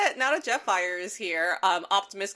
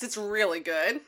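ordinary delivery

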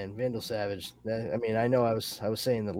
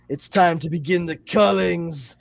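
loud, high megalomaniac proclamation; mock villain's rallying cry; Zod; 8 semitones higher, 8 dB louder than usual. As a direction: announce it with hammed-up villainous relish. Acting out megalomaniac Zod's eager reply.

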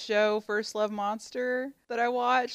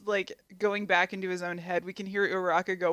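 usual speaking voice

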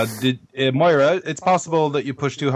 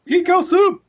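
excited shout; 6 dB louder than usual. Real, not acted. Excited shout of podcast name.

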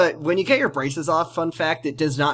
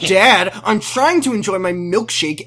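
put-on raised-pitch bratty teen complaint; imitated irritated daughter; mock annoyance; 5 semitones higher, 4 dB louder than usual. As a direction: whinge at dad, higher pitched. Acting Amy complaining to dad.

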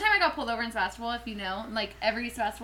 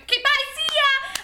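high, loud, hasty farewell; eager goodbye; 13 semitones higher, 5 dB louder, slower than usual. Retold or imitated. Driver let off by the cop, eager goodbye. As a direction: sing out a much higher, louder goodbye. Acts out gleeful escape from cop.

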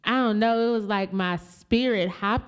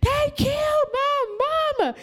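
hysterical news; 14 semitones higher, slower than usual. Retold or imitated. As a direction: tell the boss in a breaking rush. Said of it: "Then I called my boss".

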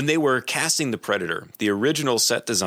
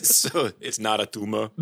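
Arnold impression. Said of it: "Arnold's best movie, catchphrases".

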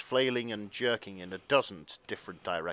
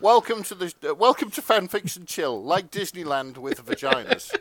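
announcer intro; 9 semitones higher, 7 dB louder than usual. Pretend. Mock show intro announcer.

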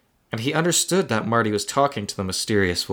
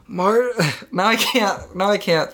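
laughing breaking character; 9 semitones higher than usual. Real, not acted. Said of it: "can't think of a wrinkly Zachary Quino".

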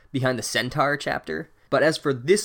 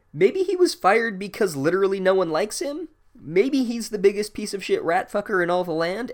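higher-pitched imitation of speculating kids; mock gleeful gossip; 7 semitones higher than usual. Boys wondering where the hated teacher is, mock gleeful. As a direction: pitch it up, hopefully guessing.

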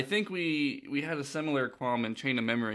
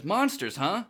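put-on higher-pitched cocky young hero; Zack; 6 semitones higher, slower than usual. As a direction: size up the enemy in a character voice. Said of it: "And Zack says".

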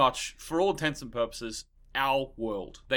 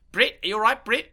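put-on higher, louder, broad London accent; exaggerated London accent; 9 semitones higher, 8 dB louder than usual. Host imitates the co-star's overdone London accent. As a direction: ham up a thick London voice calling out to Brit. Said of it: "it was almost so London".

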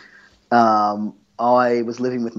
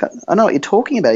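flustered rush; 8 semitones higher, 4 dB louder, much faster than usual. Retold or imitated. Flustered fast reply.

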